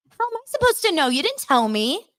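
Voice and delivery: very high squeaky mimic; hammed-up bratty whine; mocking Larsa. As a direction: repeat it like a broken record. Mocking Larsa's repeated retort.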